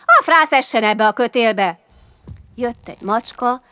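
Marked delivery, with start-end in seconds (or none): 0.1-1.8 loud irritated outburst; raised, angry cursing; angry shouting. A narrator's reading. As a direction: shout the curse like a cross chimney sweep. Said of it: "s dühösen kiabált".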